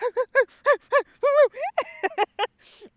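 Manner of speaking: high loud long laughter. Real, not acted. Remembering her clip, loud high laughter.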